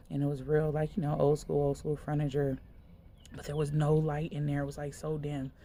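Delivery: ordinary talk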